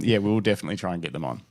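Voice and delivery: ordinary talk, nothing marked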